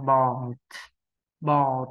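"bò" closed with a silent clipped t, no ờ after; teacher modelling the clipped final t; final t unreleased. Shown as a demonstration. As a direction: stop short on the final t of "bò". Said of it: "hãy cố gắng bỏ đi âm ờ phía sau".

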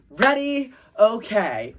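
high, loud cheer call; cheerleader call. Pretend. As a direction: shout it like a cheerleader. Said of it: "Make up a cheer".

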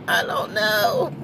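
slow, breathy laughing I don't know; playful put-on voice, laughing; Eddie King voice. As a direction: drawl it out through a laugh in the put-on voice. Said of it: "In my Eddie King's voice".

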